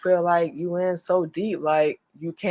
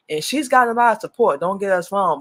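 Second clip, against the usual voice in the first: normal talking voice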